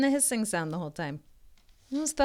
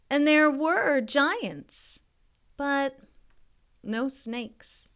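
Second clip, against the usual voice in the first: slow dramatic reveal; 4 semitones higher, much slower than usual. A narrator's reading. Revealing the garden monsters to young listeners, slow dramatic reading. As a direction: savour the surprise of monsters. Reads picture book aloud.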